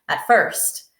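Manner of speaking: ordinary delivery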